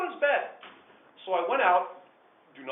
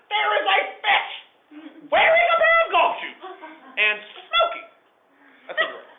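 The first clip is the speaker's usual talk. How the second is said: much higher and louder, laughing through it; near-hysterical laughing yell; hysterical disbelief; 11 dB louder than usual. Retold or imitated. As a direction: son losing it at the absurd fish. Hysterical son describing fish.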